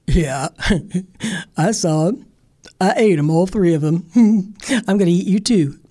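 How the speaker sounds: gruff bear laughing between words. Acted. Bear laughing at Granny before eating her. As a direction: gloat and chuckle.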